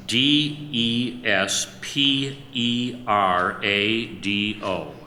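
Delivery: slow letter-by-letter spelling. Shown as a demonstration. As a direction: spell each letter out slowly. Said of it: "Desperado is spelled".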